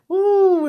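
high, held whoop of feeling; high "ooh". Real, not acted. Remembering the power at revival, a high held whoop. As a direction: let out a high, loud "ooh".

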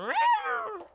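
very high squeaky meow; high-pitched kitty meow; cat meow. Retold or imitated. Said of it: "my cat money go".

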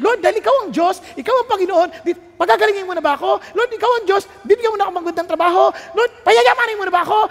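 high, loud, fast pleading; eager, demanding prayer. Acted. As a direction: press God with one request after another. Acted eager, demanding prayers to Lord. (sabik at mapilit na panalangin)

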